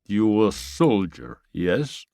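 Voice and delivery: put-on slow Russian-accented question. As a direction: size him up slowly. Russian Sasha questions Bruno.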